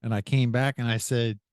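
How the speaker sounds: ordinary talk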